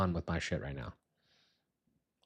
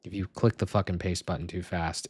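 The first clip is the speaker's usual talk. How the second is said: ordinary talk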